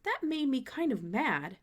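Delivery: ordinary delivery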